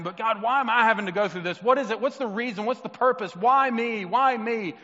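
mock his own whiny complaint to God; self-mocking whining prayer; complaining prayer. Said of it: "I find myself talking to God saying".

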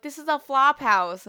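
high, loud mock disdain; a haughty guest scoffing at a cheap hotel; snooty complaint. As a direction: sniff at the room like a snob. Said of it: "She's like".

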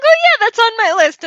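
high, loud, fake cheerful promise; overeager brush-off. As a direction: chirp it with hollow enthusiasm. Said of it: "and I'll be like".

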